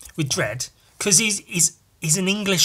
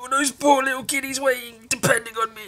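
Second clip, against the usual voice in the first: mock Santa voice; 8 semitones higher, faster than usual.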